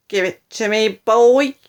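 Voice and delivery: loud, bossy demand; yelling. A narrator's reading. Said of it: "yelled Grandma".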